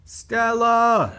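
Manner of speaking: loud high mock shout of the name; calling out. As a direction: call her name out across the room.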